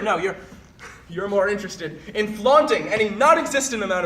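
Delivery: high, loud, escalating rant; angry tirade. Acted. Berating a former friend for flaunting false authority, angry. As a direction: berate them forcefully, peaking in shouted jabs.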